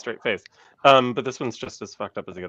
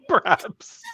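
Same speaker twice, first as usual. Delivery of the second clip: laughing. Real, not acted. Laughing at joke.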